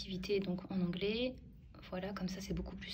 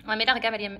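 ordinary delivery

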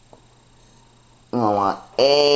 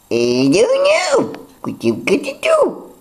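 talking in a much higher silly voice; odd high voice. Real, not acted. Vlogger laughing at his own silly high voice. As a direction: pitch it way up and laugh at yourself. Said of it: "I don't know why I'm talking like this".

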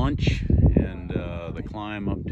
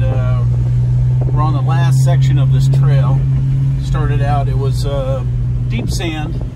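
loud, raised voice over engine noise; shouting over road noise; 5 semitones higher, 12 dB louder than usual. Real, not acted. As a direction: project over the car noise. Talking in car over road noise.

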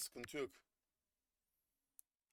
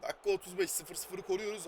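football commentator; 6 semitones higher, 5 dB louder than usual. Pretend. Playing commentator on match.

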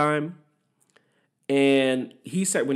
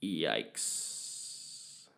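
whispered, much lower, deflated yikes; audience cringe; 7 semitones lower, 14 dB quieter than usual. Retold or imitated. The audience reacting to Diaz's flat joke, in a whispered low yikes. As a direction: breathe it out like a cringing crowd. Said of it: "Everyone in the audience was kinda like".

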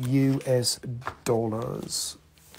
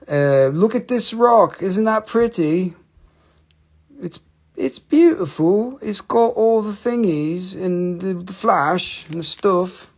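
higher, slow put-on British accent; hammed-up British accent, drawn out and raised; 6 semitones higher, 4 dB louder, slower than usual. Streamer shows a rock to viewers in mock British accent. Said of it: "if I put on a British, British accent".

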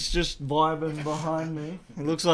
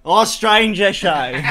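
laughing; 7 semitones higher, 10 dB louder, slower than usual. Real, not acted. Cheering a rare item drop.

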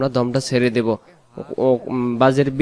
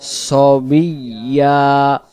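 recitation; 3 semitones higher, 4 dB louder, much slower than usual. Shown as a demonstration. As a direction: sing out the verse slowly. Teacher recites the verse slowly.

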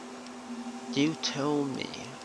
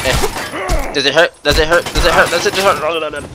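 taunting sing-song; 5 semitones higher, 7 dB louder than usual. Pretend. Taunting chant in game.

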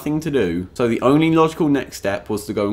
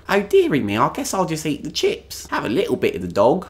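higher, politely resigned mock English voice; reserved English diner; 5 semitones higher than usual. English diner settling for the chips, mock meek resignation. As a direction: put on a timid, uncomplaining English diner. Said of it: "in England instead, we're like".

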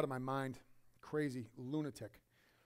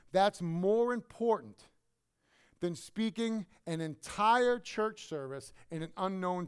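drawn-out, louder emphatic preaching; slow emphatic stress; 3 semitones higher, 6 dB louder, slower than usual. Real, not acted. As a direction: hammer each word slowly home. Preacher stressing his point.

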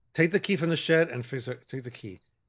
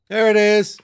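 louder, higher relieved cry; relieved exclaim; 6 semitones higher, 11 dB louder, slower than usual. Real, not acted. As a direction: shout it out with relief. Finally finds the flashlight.

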